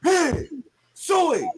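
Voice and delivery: high, loud Razorback hog-calling chant. Acted. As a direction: call the hogs at full voice, way up high. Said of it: "you know what I'm gonna do".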